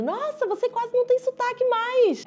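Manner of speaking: high, bright mock compliment voice; imitating praising locals. Locals praising her lost accent, lightly imitated high. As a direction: parrot the locals' praise in a lifted voice. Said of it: "de pessoas dizendo".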